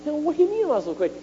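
high, fast disbelieving protest; incredulous retort. Retold or imitated. Answering the pastor friend's blunt verdict, high and fast. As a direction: shoot back, shocked and high.

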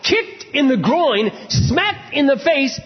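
high, slow, stretched-out exclamation; impassioned preaching. Real, not acted. Preacher telling the unmarried how hard silence is, impassioned. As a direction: cry out each phrase with heavy emphasis. Preaches at full force about marriage.

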